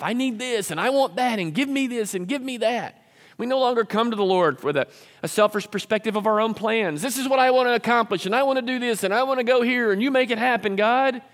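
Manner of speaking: high, insistent list of wants; mock demanding prayer. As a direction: imitate a selfish person pestering God. Mimics selfish, demanding prayer.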